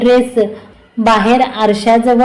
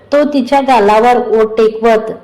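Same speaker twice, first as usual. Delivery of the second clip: usual speaking voice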